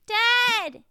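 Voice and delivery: loud, high call to dad. Acted. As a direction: yell for your father from outside. Kid calls out to dad.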